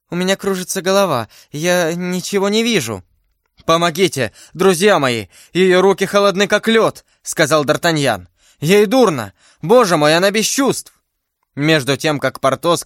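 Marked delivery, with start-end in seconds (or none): 0.1-3.0 high voice of rising distress; lightly acted dizzy alarm; faintness. Poisoned heroine telling her friends she is fainting, distressed. 3.6-7.0 urgent call, climbing higher and louder; alarmed plea. Acted. D'Artagnan to his friends as she faints, alarmed. Hero calls help for fainting beloved. 8.6-10.9 high, urgent exclamation; alarmed outcry. Acted. D'Artagnan seeing his beloved faint, alarmed outcry.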